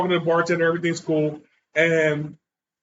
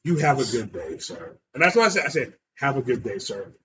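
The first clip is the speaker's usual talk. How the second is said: mock polite farewell; 6 semitones lower than usual. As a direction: exaggerate the politeness to defuse him. Ironic polite farewell to drunk.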